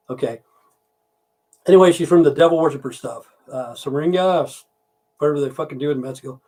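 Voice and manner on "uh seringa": ordinary talk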